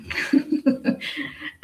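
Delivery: quiet breathy chuckle; soft laugh. Real, not acted. Remembering first hearing the song, quiet chuckle. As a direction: chuckle under your breath.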